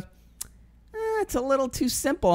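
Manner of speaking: high, soft "eh" then a shrug; mock dismissive voice. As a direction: brush it off like it's beneath you. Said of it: "He goes".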